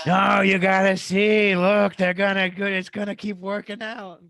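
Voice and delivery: loud, slow, pleading gambler hype; hammed-up desperate bettor begging the bet to hit; Adam Sandler Uncut Gems impression. Friend mocking his gambling weekend, loud slow gambler voice. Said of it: "turned into Adam Sandler's character in Uncut Gems".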